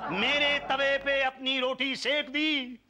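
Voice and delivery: high, drawn-out wail in verse; theatrical lament. Acted. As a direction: stretch each line into an aggrieved wail. कवि अपने नुकसान का रोना रोता है. (खींच-खींचकर नाटकीय विलाप)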